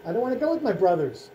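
ordinary talk, nothing marked

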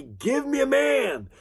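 high ringing challenge cry. Acted. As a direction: call out to a whole army. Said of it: "crying out to the Israelite army".

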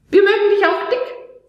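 put-on cheeky, loving little boy; small boy comforting his mum; Leon's voice. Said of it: "sagt Leon".

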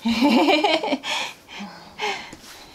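high, breathy burst of giggles; real giggle tapering off; giggling. Real, not acted. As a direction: let a long giggle spill out. Laughs alone after thanking viewers.

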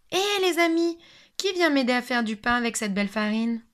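little hen calling her friends for help. Acted. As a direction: call out to the neighbours cheerfully. Hen calls her neighbours.

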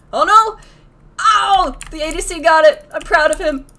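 loud, high cry easing off; excited high shout settling down; excited exclaiming. Real, not acted. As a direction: burst out high, then cheer the teammate. Teammate secures the objective.

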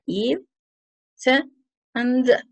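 teacher separating the three endings "id", "t", "d"; ED endings demonstrated. Shown as a demonstration. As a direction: sound out "id", "t", "d" with clear gaps between. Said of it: "tres formas de pronunciar esta E D".

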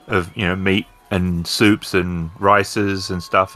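ordinary talk, nothing marked

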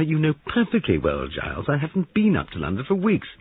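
high, loud insistence; indignant denial. Acted. Molly denying a London trip to Giles, indignant. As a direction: insist against the accusation.

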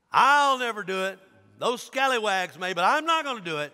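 loud, high insistence; hammed-up swaggering refusal; Peter. Disciple swearing he will never deny, loud boast.

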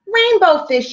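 high, light little fish voice; little fish calling. Acted. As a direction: call out as a small eager fish. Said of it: "he called".

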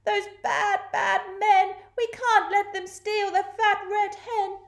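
high cat-like wail; meowing cats. A narrator's reading. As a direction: wail in protest like two indignant cats. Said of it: "the two cats meowed".

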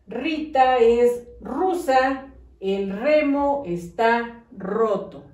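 slow, careful dictation reading; slow sentence-by-sentence read-back. Shown as a demonstration. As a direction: read each sentence slowly for children. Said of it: "Y quedó".

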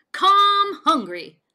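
high, very loud, slow booming invitation; hearty call. A narrator's reading. Sheriff loudly inviting Bullfrog to the contest. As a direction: boom it out like a welcome.

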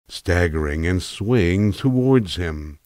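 ordinary delivery

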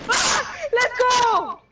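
long, high, loud drawn-out cheer; hype cheer. Real, not acted.

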